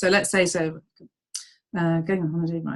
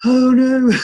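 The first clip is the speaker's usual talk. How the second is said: gasp, then a loud, high mock wail; hammed-up dismay, louder on the last words; mock horror; 3 semitones higher, 6 dB louder than usual. Voicing dismayed listeners who thought they sold aloe, mock horror.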